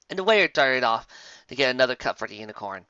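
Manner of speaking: usual speaking voice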